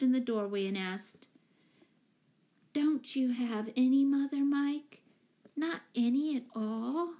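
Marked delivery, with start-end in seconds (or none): 2.7-7.1 lightly voiced small child, innocent question; little girl. Betsy asking Mike about his mother, lightly childlike. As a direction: ask it like a curious little girl.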